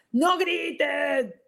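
high, drawn-out mock shout; reactive parental yell. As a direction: snap into an automatic yell at the kids. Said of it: "estoy saliendo de acá".

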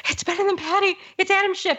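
high, squealing fangirl voice; giddy squeal. Retold or imitated. Telling her husband who she just saw, high giddy squeal. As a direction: squeal it like a starstruck fan. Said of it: "I'm like".

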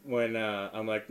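ordinary talk

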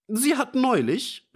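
put-on high-pitched indignant old man cutting in; calling out, interrupting. A narrator's reading. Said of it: "rief der alte Fischer dazwischen".

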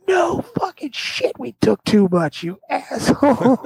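high, slow exasperated friend voice, laughing at the end; mock serious friend. Quoting his buddy scolding him, high, slow and exasperated. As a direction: drag it out like a fed-up buddy. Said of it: "with a serious look on his face".

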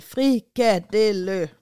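Frikadelle repeated very slowly, syllables stretched; slow stretched Frikadelle with a falling end; copying the name. Retold or imitated. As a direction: carefully imitate "Frikadelle" slowly. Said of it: "Og så siger jeg".

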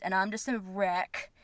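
ordinary delivery

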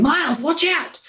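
high mock yell of warning; imitated uncle's yell. Player imitating the game's repeated audio bug, mock yelling. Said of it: "Miles's uncle yelling".

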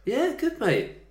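very high, squeaky put-on answer; strained cheerful reply. Man answering how his Christmas was, strained and high. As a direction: pitch way up on a drawn-out yeah.

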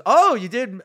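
high, loud, impressed "oh"; sudden double take of respect; surprised. Retold or imitated. Host reacting to comedian's credit, high surprised.

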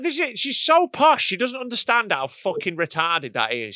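high, loud incredulous rant; indignant rant. Real, not acted. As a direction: climb up high and loud in disbelief. Ranting about posh wife.

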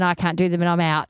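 normal talking voice

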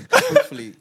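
high laughing squeal. Real, not acted. Telling friends he hoped it would pass, high laughing squeal. Joking hope it passes.

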